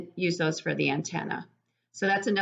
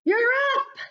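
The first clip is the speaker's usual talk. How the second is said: much higher, louder cheering bug voice; cheering parent bug; 13 semitones higher, 3 dB louder, slower than usual. Acted. Parent bug cheers the baby flying, high and excited. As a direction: cheer the little one through its first flight.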